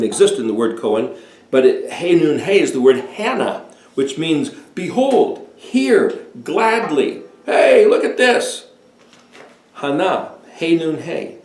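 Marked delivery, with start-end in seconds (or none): 4.7-8.7 excited proclamation. Pretend. Acting out the word's meaning.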